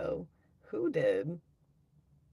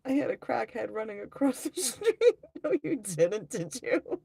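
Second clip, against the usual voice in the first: higher, louder, breaking into laughter; laughing while talking; 6 semitones higher, 6 dB louder than usual. Real, not acted. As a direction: crack up halfway through reading it out. Cracks up reading a chat message.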